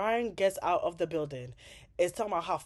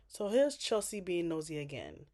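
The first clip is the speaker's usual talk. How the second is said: ordinary talk, nothing marked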